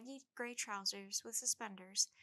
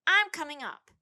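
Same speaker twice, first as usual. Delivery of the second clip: defiant yell; 6 semitones higher, 16 dB louder than usual. A narrator's reading. Tad defiant yelling.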